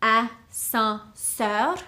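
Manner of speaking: ascenseur stretched into three syllables, rising at the end; ascenseur, spelled out by sound for learners; slow drawn-out ascenseur. Shown as a demonstration. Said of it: "three syllables".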